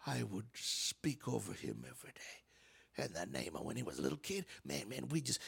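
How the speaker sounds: low, soft confiding whisper; hushed whisper. Acted. Parent confiding about a son, hushed whisper. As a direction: drop it to a secret hush.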